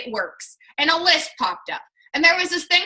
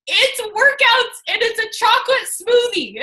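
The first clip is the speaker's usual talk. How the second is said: excited explanation; 8 semitones higher, 3 dB louder than usual. Retold or imitated.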